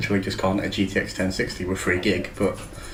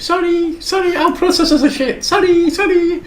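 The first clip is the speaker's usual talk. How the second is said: very high squeaky mock apologising; squeaky caricature of a company saying sorry; mock grovelling apology; 4 dB louder than usual. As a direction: put on a high-pitched voice and over-apologise. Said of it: "A M D apologise every fucking day".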